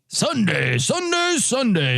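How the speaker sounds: booming announcer hype, Sunday rising; monster truck ad. Retold or imitated. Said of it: "monster truck commercial where they say".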